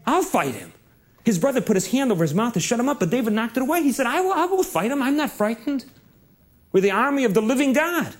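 put-on high, eager boy's voice pleading to fight; a boy insisting, pitch up; young David, eager.

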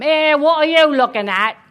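put-on loud, high, grumpy English-accented tree. A narrator's reading. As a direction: challenge them loudly in a rough English accent. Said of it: "the tree spoke with an English accent".

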